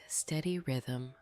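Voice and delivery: ordinary delivery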